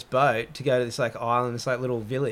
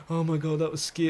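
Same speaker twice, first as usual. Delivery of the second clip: retold breathless relief; catching breath; 3 semitones higher than usual. Swimmers laying there on the island, catching breath. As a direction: act lightly winded after a scare. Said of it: "trying to catch our breath".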